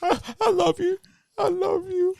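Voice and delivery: high, soft pleading lover; mock pleading. A co-host voices the slapped man pleading love, soft and high. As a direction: beg sweetly, pitched high.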